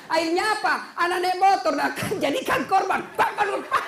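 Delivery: high, loud, laughing; excited punchline. Real, not acted. Preacher climaxing the police joke, high and loud. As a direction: build the joke to a burst.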